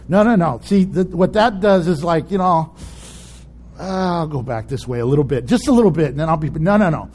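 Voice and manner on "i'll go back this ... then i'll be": low, noncommittal hedging; sheepish backsliding. Acted. Sinner excusing going back a little, sheepish and low.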